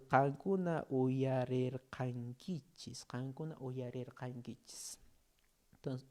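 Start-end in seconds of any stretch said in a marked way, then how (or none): 0.1-5.0 "Qankuna uyarerqankichis" drawn out syllable by syllable; slow model reading. Shown as a demonstration. Teacher modelling Quechua for online learners, slow and careful. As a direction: read it slowly so learners catch each sound. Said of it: "yo les he puesto más lento".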